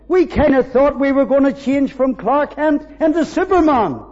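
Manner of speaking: loud, high, rising preacher's cry; fervent preaching. Acted. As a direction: lift the room with swelling praise. Preaching at full force.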